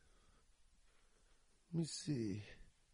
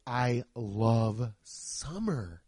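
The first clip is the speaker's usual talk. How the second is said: slow, dragged-out mocking imitation; 5 semitones lower, much slower than usual. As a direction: parody a summer lover with disdain. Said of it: "the people that are like".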